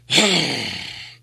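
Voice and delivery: a long tired sigh before the story; weary sigh. A narrator's reading. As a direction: let out a heavy sigh. Thorin sighs before his tale.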